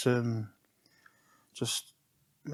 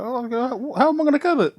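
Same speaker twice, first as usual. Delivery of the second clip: confused high quote; 12 semitones higher, faster than usual. Retold or imitated. Boys unsure how to cut.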